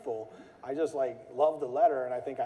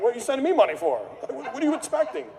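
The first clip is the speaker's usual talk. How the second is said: much higher, louder laughing outrage; mock indignant seller; 11 semitones higher, 7 dB louder than usual. Seller offended by money in the mail, mock outrage. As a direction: scoff at the offer, hammed-up. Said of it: "they're like".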